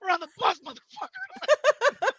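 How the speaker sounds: high, slow, laughing through the words; can barely get it out for laughing; real laughter on the catchphrase. Real, not acted.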